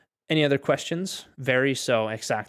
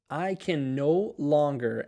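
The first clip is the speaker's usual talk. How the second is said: slow; much slower than usual. Shown as a demonstration. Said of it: "teacher models phrase slowly".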